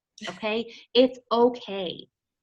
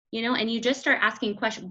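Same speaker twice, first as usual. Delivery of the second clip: normal talking voice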